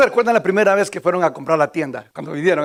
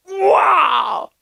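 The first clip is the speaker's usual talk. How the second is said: loud, high cry of wonder; sudden shouted gasp of amazement; amazed wow; 12 semitones higher, 4 dB louder than usual. Retold or imitated. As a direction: relive the awe of a first sight. First sight of five-lane highways.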